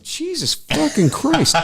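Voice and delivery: drawn-out, very high-pitched oath; comic aghast outburst; appalled exclamation. Retold or imitated. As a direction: replay exasperated shock, stretched out. Appalled reaction to boss.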